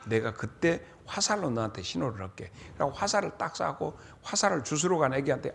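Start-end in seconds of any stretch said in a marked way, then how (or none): none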